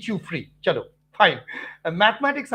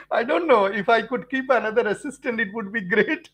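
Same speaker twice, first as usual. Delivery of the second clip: words spoken through laughter; laughing while talking; 2 semitones higher than usual. Real, not acted. Teacher joking live with viewers, laughing through words. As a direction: chuckle through the joke.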